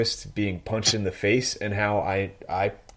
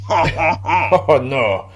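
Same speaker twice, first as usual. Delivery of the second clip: put-on joking French accent, a bit louder; 6 dB louder, slower than usual. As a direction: do a light mock French-accented player. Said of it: "say it with your French accent".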